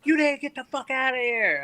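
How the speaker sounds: high-pitched frantic order; freaking-out mock yell. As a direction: imitate a friend frantically clearing everyone out. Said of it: "He was freaking out".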